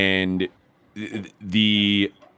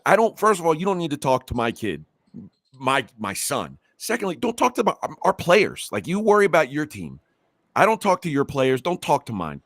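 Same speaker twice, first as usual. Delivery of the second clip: higher-pitched, insistent scolding; retold protective rebuke, voice pitched up; indignant telling-off; 4 semitones higher than usual. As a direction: lay down the law to a rival coach.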